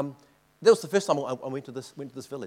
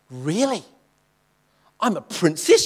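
delighted disbelief; 2 semitones higher, 8 dB louder than usual. Retold or imitated. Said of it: "she goes".

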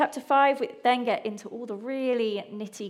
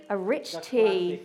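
slow, drawn-out announcement of "a rich tea"; slow reveal; 4 semitones lower, slower than usual. Acted. Dramatic reveal of Freddie's biscuit.

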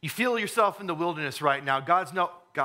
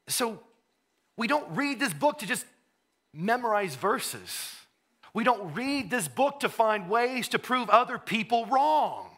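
higher, louder preacher's build; fervent preaching; 8 semitones higher, 3 dB louder than usual. Acted. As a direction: drive the point home from the pulpit. Preaching full force.

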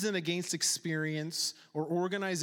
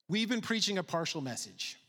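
usual speaking voice

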